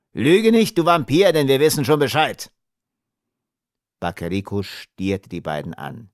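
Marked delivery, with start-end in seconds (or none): 0.1-2.5 higher, louder angry outburst; angry warning. Acted. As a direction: cut the liar off angrily. Smuggler threatens the captive.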